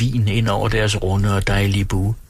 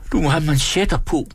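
higher, fussing mother; fussy scolding; 6 semitones higher than usual. Acted. Hostess scolds the son about cuffs, fussing. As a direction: nag him to dress.

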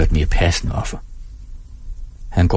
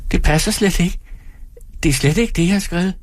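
put-on high, childlike woman; small thin voice, pitched well up; childish thin voice; 10 semitones higher than usual. A narrator's reading. Timid audience member answers the performer, childish thin voice. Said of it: "Hendes stemme er barnlig og tynd".

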